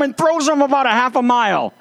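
usual speaking voice

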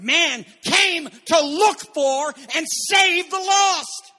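slow build, rising high to a loud shout on lost; preaching climax. Real, not acted. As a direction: build slowly, then cry out the lost.

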